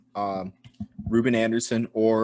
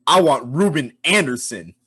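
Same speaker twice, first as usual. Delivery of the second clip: raised, emphatic declaration; emphatic demand; 4 semitones higher, 5 dB louder than usual. Acted. Punter pick for a last-ditch game, emphatic demand. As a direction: call for your player with force.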